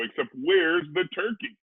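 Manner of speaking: ordinary delivery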